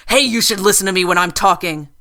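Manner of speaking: loud, sharp demand; angry demand. A narrator's reading. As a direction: snap at her to listen. Gunwoman jabs gun at Lizzie.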